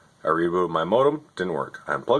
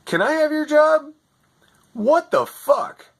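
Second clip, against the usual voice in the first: high, pitched-up furious rant; 3 dB louder than usual. Retold or imitated. Yelling at the tech agent who couldn't fix his internet, furious. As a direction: screech at the useless agent in disbelief. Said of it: "And I was so furious".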